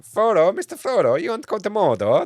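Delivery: a hammed-up West Country accent; bad West Country accent. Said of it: "That accent was terrible".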